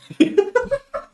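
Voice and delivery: very high squealing laugh. Real, not acted. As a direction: break into giggles. Laughing after death scene.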